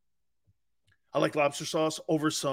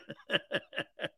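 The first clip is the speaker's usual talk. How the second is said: quiet run of chuckles; real soft laugh; 3 semitones higher, 9 dB quieter than usual. Real, not acted. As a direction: chuckle softly at your own put-down. Laughs after his put-down.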